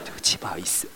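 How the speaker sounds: soft, breathy, near-whispered sorry; meek apology. Retold or imitated. Pushed-back attendant apologizing to angry riders, hushed.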